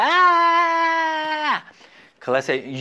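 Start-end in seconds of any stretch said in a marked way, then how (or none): 0.0-1.6 long, high-pitched held "aah"; acted tantrum yell. Teacher shows the class how he used to scream, mock tantrum yell. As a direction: yell like a spoiled child, high and sustained. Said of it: "yo gritaba".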